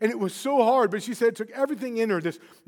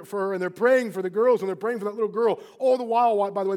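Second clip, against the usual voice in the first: ordinary delivery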